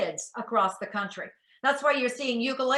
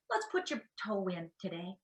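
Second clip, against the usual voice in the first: murmured gentle coaxing, much softer; soft coaxing parent; 4 semitones lower, 11 dB quieter than usual. Retold or imitated. Parent gently coaxing a scared child into the pool, softly.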